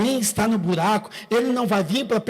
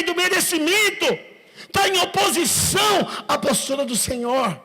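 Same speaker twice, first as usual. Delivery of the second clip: preaching at full force; 7 semitones higher than usual. Acted.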